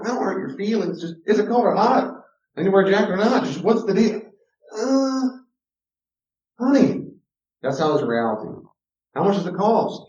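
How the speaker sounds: high, hammed-up voice dodging the truth; mock evasive person. Mocking someone avoiding plain truth.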